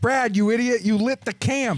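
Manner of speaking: high, loud scolding; exasperated. Acted. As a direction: yell at a clumsy friend. Friend scolds Brad for fire.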